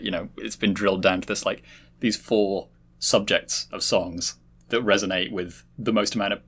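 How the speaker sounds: ordinary talk, nothing marked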